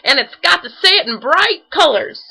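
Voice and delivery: bouncy and eager; excited. A narrator's reading. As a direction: Tigger excitedly demanding bright colors. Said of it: "bouncing around Pooh's parlor in excitement".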